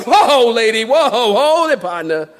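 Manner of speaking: hammed-up halting protest; comic taken-aback retort; mock alarm. Acts out halting the shocked lady.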